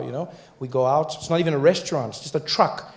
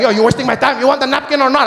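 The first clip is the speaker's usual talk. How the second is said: high, loud impatient vendor voice; a high, loud demand for an answer; impatient taco guy; 10 semitones higher, 7 dB louder than usual. Retold or imitated. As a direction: hurry him up like a fed-up vendor. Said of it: "He's like".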